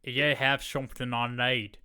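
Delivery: a hint of a menacing London villain; London accent. Mocking the villain's London accent to his friend. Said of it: "he has a very thick London accent".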